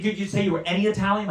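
ordinary talk, nothing marked

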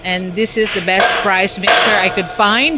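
ordinary talk

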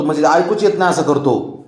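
usual speaking voice

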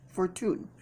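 FORTUNE in a French-ish accent, softly tried out; quiet attempt at French-accented "Fortune"; unidentifiable foreign accent. Shown as a demonstration. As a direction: say "Fortune" as mock French, for-TOON. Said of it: "I don't know what accent that is".

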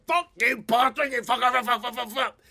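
high, loud stream of curses; mock swearing match. Two men cursing each other in the middle of the street, yelling. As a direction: yell the swears like a street brawl.

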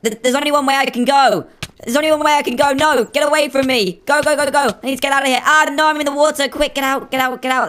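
high, urgent escape chatter; hammed-up alarm while fleeing; playful panic. Fleeing toys in the game.

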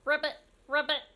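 playful frog croaks; two short ribbits. Shown as a demonstration. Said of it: "What sound does the frog make?".

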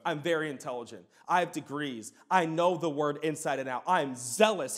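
a hint of an arrogant Pharisee; mock boastful. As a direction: imitate a proud know-it-all. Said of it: "could say".

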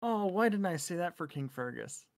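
ordinary delivery

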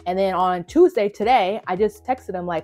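furious rant. Real, not acted. Vlogger blasting the union over a closed request, furious. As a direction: build to a furious outburst. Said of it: "I am so fucking pissed".

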